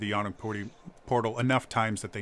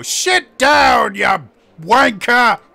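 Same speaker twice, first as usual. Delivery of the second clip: high, loud insult shouted; angry yell; 12 semitones higher, 13 dB louder, much slower than usual. Acted. Patron yells insult across the tavern, loud and high. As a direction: hurl it across a brawl. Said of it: "another guy go".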